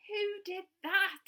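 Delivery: a touch of cross squirrel; mild crossness. Acted. Squirrel, knocked out of bed, asks with mild crossness. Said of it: "Asked the squirrel crossly".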